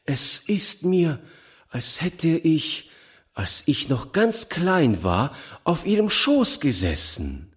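put-on remember it wonderingly, like a child. A narrator's reading. Child recalls early memory.

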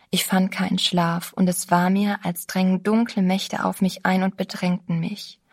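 plain reading voice

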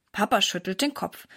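ordinary delivery

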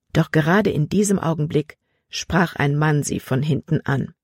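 ordinary delivery

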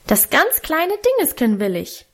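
put-on high, insistent child demanding; urging toddler. A narrator's reading. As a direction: push harder like a small boy. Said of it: "drängte Hermann noch lauter".

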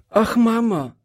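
put-on high, urgent child's cry; high-pitched exclamation of a girl; calling out as Gretchen. A narrator's reading. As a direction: call to mother in a high little-girl voice. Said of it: "rief Gretchen".

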